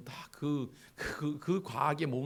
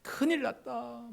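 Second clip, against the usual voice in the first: mock crying student; 6 semitones higher, 3 dB quieter than usual. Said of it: "울고 있단 말이에요".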